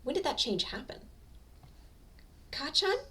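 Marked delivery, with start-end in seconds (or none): 2.5-3.0 whimper. A narrator's reading. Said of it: "he whimpers".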